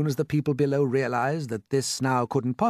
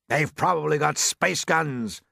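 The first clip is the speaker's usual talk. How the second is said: plain reading voice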